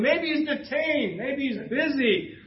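taunting prophets whose god is in jail; mocking taunt. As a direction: poke fun at the stuck god. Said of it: "Elijah's actually poking at that".

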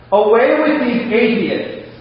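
high, slow, ringing shout to heaven. Acted. Old prisoner shouting back at the hostile crowd, loud and slow. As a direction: call it out loud and slow to the sky. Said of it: "and shouted".

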